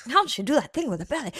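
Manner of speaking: put-on quick, high transatlantic accent; breezy old-Hollywood transatlantic accent. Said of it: "I don't know why I went, like, transatlantic accent".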